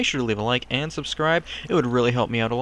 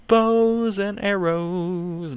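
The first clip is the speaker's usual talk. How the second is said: very slow, higher, stretched-out vowels; drawn-out gloating; 6 semitones higher, 3 dB louder, much slower than usual. Real, not acted. Gamer relishing new loot, drawn-out gloating. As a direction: stretch every word out in mock grandeur.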